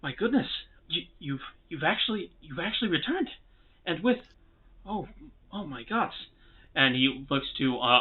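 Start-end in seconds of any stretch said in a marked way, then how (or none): 0.0-6.1 stammering, high-pitched disbelief; dwarf overwhelmed with amazement; shocked. Acted. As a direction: gasp it out haltingly, astonished. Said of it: "is very shocked to see that".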